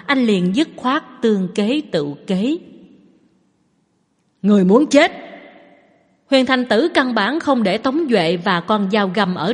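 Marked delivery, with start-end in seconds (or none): 4.4-5.1 furious threat, loud and hard; angry shout. A narrator's reading. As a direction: bark the threat with killing rage. Villain threatens in fury. (tiếng quát giận dữ)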